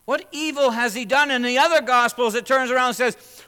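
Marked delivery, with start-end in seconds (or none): 0.0-1.3 higher and louder, driving the question home; louder, pressing repeat. Acted. Preacher repeats Pilate's question to the church, louder. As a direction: demand it of them.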